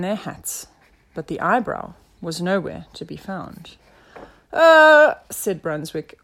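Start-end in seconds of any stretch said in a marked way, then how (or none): 4.5-5.1 loud, high drawn-out uh; caught-out detective's uh; stalling inspector. A narrator's reading. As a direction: stall for a new theory.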